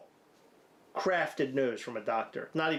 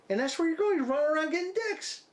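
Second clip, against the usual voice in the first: mocking squeal; 11 semitones higher, faster than usual. Boyfriend mocking his partner's new life, high fast mocking squeal. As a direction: squeal it like a gleeful tease.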